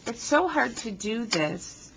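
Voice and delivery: ordinary delivery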